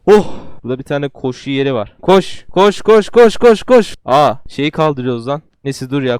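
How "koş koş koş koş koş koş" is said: building, louder and higher run commands; frantic urging. Real, not acted. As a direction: cheer the runner on faster. Urging character on treadmill.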